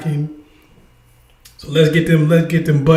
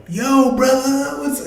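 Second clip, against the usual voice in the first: slow, drawn-out mock bro greeting; 8 semitones higher, slower than usual. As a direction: imitate someone faking a street voice.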